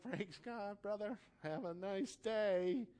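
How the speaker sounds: high, slow, sing-out farewell; polite brush-off. Acted. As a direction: send them off with exaggerated politeness. Acts out dismissing contentious people politely.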